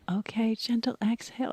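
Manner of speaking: whispered, soft teacher cue; soothing whisper. Retold or imitated. Teacher whispering to the room, soft and soothing. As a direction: breathe it out like a calm instructor. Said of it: "she has this voice that's so soothing".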